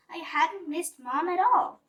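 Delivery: ordinary delivery, nothing marked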